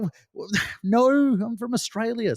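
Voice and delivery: stammering, high-pitched mock bewilderment; stammer, then protest in a high, drawn-out voice; baffled denial. Australian traveler correcting an American's wrong guess, baffled.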